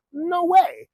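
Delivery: very high, incredulous refusal; mock refusal. New owner refusing to sell back, mock incredulous. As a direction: pitch it way up, scoffing. Acted quarrel over buying back.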